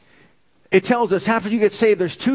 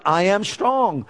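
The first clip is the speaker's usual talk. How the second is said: slow declaration; much slower than usual. Acted. Answers own call to congregation.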